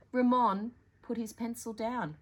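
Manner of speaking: usual speaking voice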